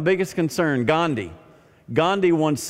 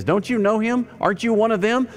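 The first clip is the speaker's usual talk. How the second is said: put-on high little girl's voice asking; a young girl by the fire asks Peter, high and curious; 9 semitones higher than usual. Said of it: "a little girl looks up at Simon Peter and says".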